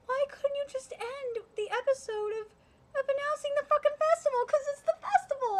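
high, loud, fast frustrated shout; exasperated outburst. Real, not acted. Fan venting at studio over festival, exasperated shout. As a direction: vent at the studio at full volume.

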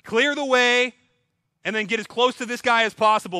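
herald's proclamation. Retold or imitated. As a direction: announce it like a herald clearing the road. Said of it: "blowing the trumpet saying".

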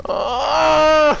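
loud, high drawn-out cry; drawn-out dismayed wail. Real, not acted. Gamer tossed by a toxic bot, dismayed wail.

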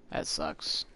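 low, deflated grumble; a quiet, low let-down; disappointed. Real, not acted. Let down by only a primal bonfire, disappointed. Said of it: "Don't sound so disappointed".

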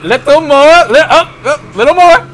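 high, loud coaxing; excited urging. Real, not acted. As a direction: egg them on as it nearly works. Urging player to keep towing.

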